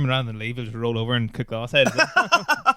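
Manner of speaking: normal talking voice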